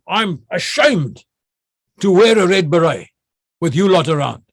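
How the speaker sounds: theatrical disgust aimed at the troops; melodramatic shame. Retold or imitated. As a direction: commander shaming his men, pitched high and theatrical. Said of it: "I got to be melodramatic now".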